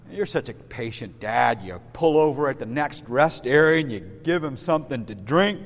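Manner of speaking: ordinary talk, nothing marked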